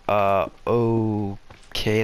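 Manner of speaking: very slow, drawn-out reaction; mock hesitant acceptance; dubious. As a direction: drag it out, clearly unconvinced. Acted sceptical reaction to news.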